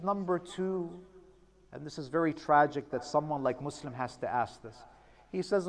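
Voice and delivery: low, hushed storytelling; quiet, grieving retelling; hushed grief. A narrator's reading. Hushed retelling of final requests.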